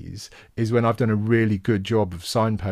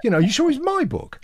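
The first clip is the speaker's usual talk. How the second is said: high, flustered mock bafflement; the author, put on the spot by a fan, in hammed-up panic. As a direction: play yourself caught out, pitched way up and baffled. His flustered reply, acted.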